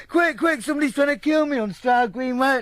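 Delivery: high, loud imitated cry for help; panicked mock yell. Chased man phoning police for help, mock panicked yell. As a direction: yelp urgently, sending up his panic.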